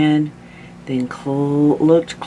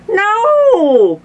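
very high, loud wail; long hammed-up old man's cry; distraught Grandpa; 7 dB louder than usual. A narrator's reading. Grandpa wails about his teeth.

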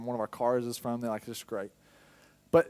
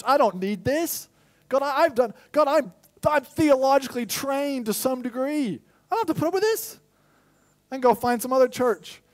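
indignant outburst; 13 semitones higher, 9 dB louder than usual. Retold or imitated. Pastor voicing his flesh quitting the church, loud indignant rant. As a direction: puff up and rant like a wounded ego. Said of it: "And my flesh says".

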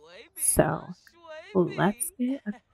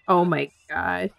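normal talking voice

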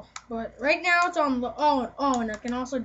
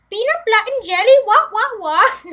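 comic high-pitched yelling impression; imitated movie outburst; 10 semitones higher, 13 dB louder than usual. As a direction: belt out the funny movie line, high and loud. Imitating funny Paul Blart movie moment.

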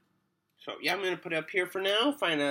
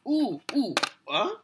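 Tim Taylor grunt; 7 semitones higher, 3 dB louder than usual. Real, not acted. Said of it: "Why am I sounding like Tim Taylor?".

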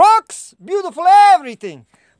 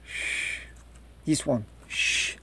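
hushed shh, barely voiced and much softer; secretive hush; 14 semitones lower, 17 dB quieter, slower than usual. Telling the viewers about his crush, in a hushed secretive shush. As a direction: shush them like sharing a secret.

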